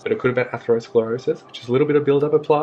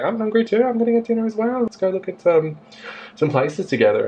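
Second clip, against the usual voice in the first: high, eager imitation of the older lady; mock older lady; 11 semitones higher than usual. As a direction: pitch up high to play the chatty older lady. Older lady voiced in story.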